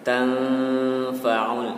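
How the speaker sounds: slow chanted "Tanfa'ul" with a held hum; clear ain. Shown as a demonstration. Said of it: "di sini ada ain lagi, harus jelas pengucapannya".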